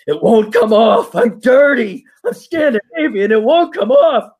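high, hammed-up wail; mock anguish. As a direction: play it as a mock tragic lament. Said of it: "it's kinda like".

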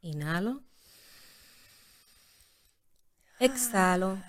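hushed meditation guide; yoga teacher voice. Shown as a demonstration. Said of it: "en tu voz de maestra de yoga".

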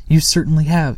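Mama impressed, voice lifted; exclaimed. Acted. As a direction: sound delighted at the pile. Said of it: "exclaimed Moomin Mama".

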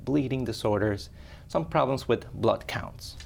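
ordinary delivery

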